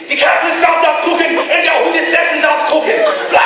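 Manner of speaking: high, loud barked German; mock menacing German shout; threatening German. Shown as a demonstration. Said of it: "and it'd be".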